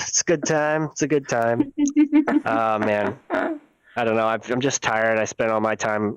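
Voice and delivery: ordinary talk, nothing marked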